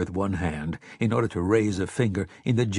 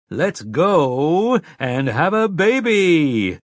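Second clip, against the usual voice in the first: loud, high, drawn-out excited announcement; slow, swooping showman's proclamation; game show host; 7 semitones higher, 6 dB louder, much slower than usual. A narrator's reading. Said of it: "proclaimed in game show host tones of excitement".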